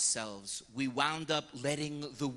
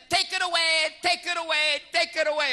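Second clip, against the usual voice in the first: higher, louder repeated plea; pleading cry; 13 semitones higher, 6 dB louder than usual. Retold or imitated. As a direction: beg him to take it away. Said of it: "I said to him".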